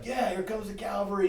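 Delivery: high excited mock cheer; cheering cavalry. Firefighter cheering the convoy rolling in, high and excited. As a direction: whoop it like the cavalry arriving.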